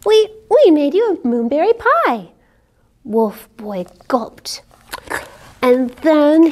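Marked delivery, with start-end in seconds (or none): 0.0-2.3 high, quick proud rabbits. Acted. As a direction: beam with pride. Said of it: "the rabbits proudly said".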